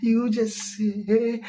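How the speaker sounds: slow, drawn-out officiant cue; mock pastor prompt. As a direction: drawl it like a pastor leading vows. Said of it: "Dear sofu beke bia".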